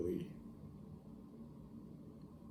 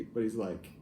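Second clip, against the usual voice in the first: usual speaking voice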